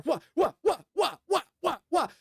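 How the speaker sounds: rapid run of high, short "wha" sounds; whooshing throw noises. Acted. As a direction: punch out one high syllable per throw. Imitating weapons thrown into air.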